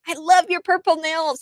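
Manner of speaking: gushing high mock fan voice; mock gushing man. Retelling the server praising her nails, gushing high. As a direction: coo it, high and delighted. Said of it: "He goes".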